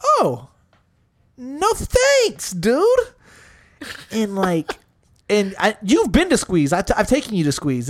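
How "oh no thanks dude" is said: high-pitched mock recoil; a squeaky, polite brush-off in your head; acted inner refusal. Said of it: "I'm thinking to myself".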